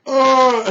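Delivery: loud, high groan of agony; pained cry. Acted. Storyteller acting the knight stabbed again, high pained cry. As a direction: cry out as if stabbed a second time.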